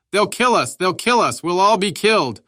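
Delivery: high, loud hysterical wail; panicked crying out. A narrator's reading. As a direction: scream it out in sheer terror. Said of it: "He began to cry and shout".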